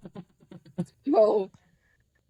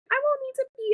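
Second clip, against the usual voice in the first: mock confident squeak; faster than usual. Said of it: "I'm like".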